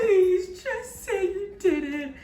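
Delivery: put-on high, slow begging little-kid voice; mock childlike whine; child pleading. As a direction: plead like a desperate ten-year-old.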